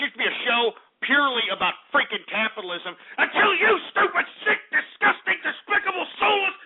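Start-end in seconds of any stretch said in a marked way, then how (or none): 3.2-6.6 rising, furious yell at the trolls, higher and higher; enraged shout. Real, not acted. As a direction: scream the insults one after another. Raging at callers ruining show.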